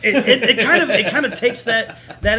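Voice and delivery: high, loud, laughing start. Real, not acted. Agreeing with the host, laughing loudly. Excited agreement about the Illuminati.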